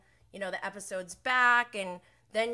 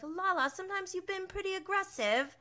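much higher, prissy put-on voice; mock Lisa; 10 semitones higher than usual. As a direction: simper it like a pearl-clutching lady. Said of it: "she's like".

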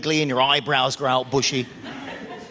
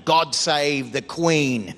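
flat deadpan title; 4 semitones lower, much slower than usual. Acted. Naming the English anthem dryly.

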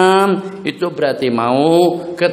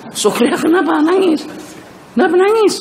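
worried question; 13 semitones higher than usual. Acted. Asking Sukriah why she cries, high and pressing. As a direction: press her for the reason, alarmed.

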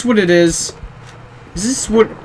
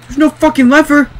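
frustrated; 7 semitones higher, 4 dB louder than usual. Real, not acted. Stuck in game, frustrated.

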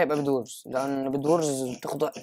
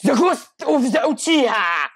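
high, loud, very slow epic title; dramatic announcer; 8 semitones higher, 7 dB louder, much slower than usual. Pretend. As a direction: announce it like a trailer voice.

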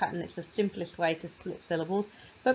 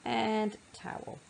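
slow, with bath and towel set apart by a pause; teacher splitting a compound word, slowly; slow segmenting; 3 dB quieter, much slower than usual. Shown as a demonstration. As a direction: pause between the two halves. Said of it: "is bath".

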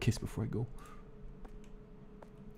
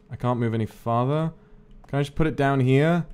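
normal talking voice

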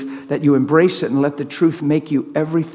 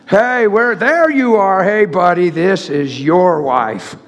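mock calling out; 4 dB louder, slower than usual. Preacher parodying God calling buddy to his wife, mock calling out. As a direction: call out to him, stretching the last words.

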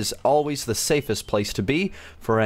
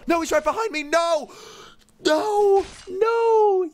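terrified screams; slower than usual. Real, not acted. Murderer behind him.

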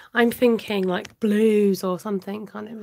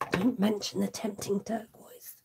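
conspiratorial whisper; 5 dB quieter than usual. Real, not acted. Joking to viewers about a retired ink, whispered. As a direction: whisper it like a forbidden secret.

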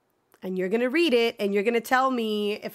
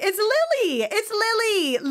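high, loud delighted cry; delighted squeal; 10 semitones higher, 4 dB louder than usual. Real, not acted. Streamer thrilled to see Lily, high delighted squeal. Happy villager reveal.

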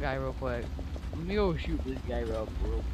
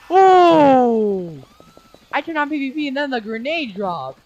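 loud high startled cry; startled shout; 7 semitones higher, 14 dB louder, slower than usual. Real, not acted. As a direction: shout in shock. Grenade suddenly drops.

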